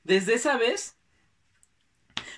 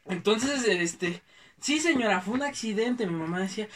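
usual speaking voice

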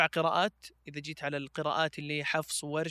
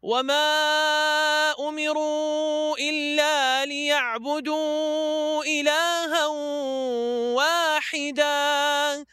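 slow, drawn-out melodic tajweed chant; Quran recitation; 6 semitones higher, 4 dB louder, much slower than usual. Acted. Reciter chanting Surah Taha for the interviewer, slow melodic recitation. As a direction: recite each verse slowly with long held notes, rising high.

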